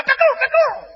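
high mouth-made gun cracks; imitated rifle shots. Father answering with his rifle, imitated shots. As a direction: mimic rifle fire. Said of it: "两步枪".

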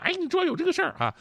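high, quick, hushed gossip; mock gossip's excited aside. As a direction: pass on a juicy secret, high and fast. Acting out people spreading gossip.

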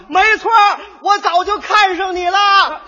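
high, loud, slow yell with a laugh; shouting. Retold or imitated. Girl yells while chasing the rider. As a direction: call it out across the street.

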